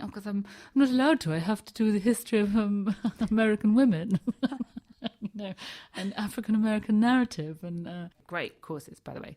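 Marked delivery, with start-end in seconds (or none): 0.0-7.9 loud, higher, laughing excuse; laughing explanation. Retold or imitated. To the tutor about her required courses, loud laughing excuse. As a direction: explain while cracking up. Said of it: "And I went".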